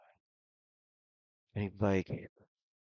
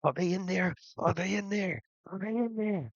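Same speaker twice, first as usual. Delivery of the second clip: high, louder, frantic repeated question; panicked; 11 semitones higher, 5 dB louder than usual. Acted. Old man trying to tear his gut open, panicked.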